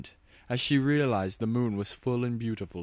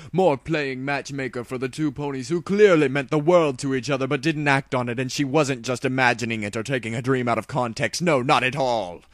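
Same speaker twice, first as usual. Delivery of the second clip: building rage; 5 semitones higher, 8 dB louder than usual. Acted. Luna's frustration boils over.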